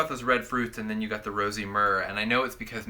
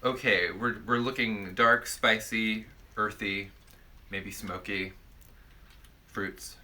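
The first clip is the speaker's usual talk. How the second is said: ordinary talk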